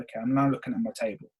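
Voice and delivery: boring and disengaged. Shown as a demonstration. Coach showing a podcast read looking at the table, boring. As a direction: sound bored while claiming excitement. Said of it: "this guy's boring".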